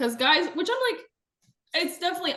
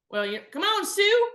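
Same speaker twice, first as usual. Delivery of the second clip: mock fake Southern accent; 11 semitones higher than usual. Woman mimicking visitor calling her dog, fake Southern accent. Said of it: "it was so much worse than that".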